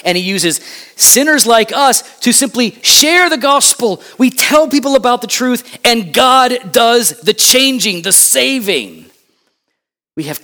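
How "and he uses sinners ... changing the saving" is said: raised, ringing pulpit delivery; full-force preaching. Real, not acted. Preacher telling church God does the saving, forceful preaching. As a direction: drive it home high, stretching the final phrases.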